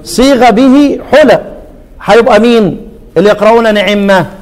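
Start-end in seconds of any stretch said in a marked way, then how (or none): 0.0-1.4 slow melodic recitation; recited quote. Shown as a demonstration. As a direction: chant it slowly. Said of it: "لا، خلاص". (إنشاد بطيء)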